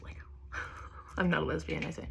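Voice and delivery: soft unvoiced laughing aside; whispered joke. Real, not acted. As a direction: breathe it out under a laugh.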